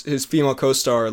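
ordinary delivery

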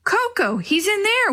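high-pitched joyful outcry; excited cry. A narrator's reading. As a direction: cry out on finding a lost pet. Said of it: "Willie cried".

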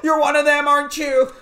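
high, loud panicked American plea; Alan Rickman fake American. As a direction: shriek for mercy in an American accent. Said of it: "Alan Rickman pretending to be an American".

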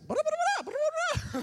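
piercing, loud nonsense chatter; ranting host mother. Retold or imitated. Host mother ordering student along, exaggerated high gibberish. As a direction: imitate her foreign torrent at top pitch. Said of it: "She's like".

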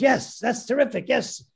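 high-pitched acted-out elation; imitating an excited producer; delighted approval. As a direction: celebrate a deal just won. Producer celebrates the sale.

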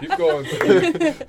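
slow, loud, laughing encouragement. Real, not acted. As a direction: cheer him on while cracking up. Cheering friend after joke.

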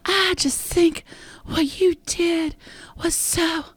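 put-on swooning girlfriend. Host mimics Alabama gushing over Clarence, slow dreamy high voice. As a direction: draw it out like a lovestruck girl. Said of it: "she's just like".

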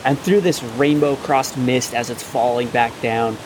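slow, hushed build-up; game master scene setting. A narrator's reading. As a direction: draw the players in slowly, revealing a monster. GM describes the dragon turtle's emergence.

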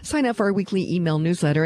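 ordinary talk

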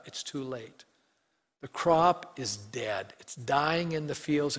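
normal talking voice

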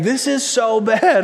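put-on slow, mild child's whine; a lighter echo of the daughter's whine; whiny little girl. Daughter rolling on the floor, mild slow whine. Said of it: "she's rolling around on the ground saying".

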